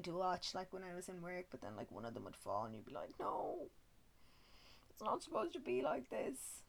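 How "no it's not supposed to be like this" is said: breathy high wail of dismay; high mock despair. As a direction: plead like tragedy struck. Horse falls at work.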